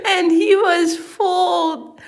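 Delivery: high, loud, slow, squeezed out through laughter; real laughing. Real, not acted. Reader resuming the story, helplessly laughing. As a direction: force the line out while cracking up. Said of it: "I can't stop laughing".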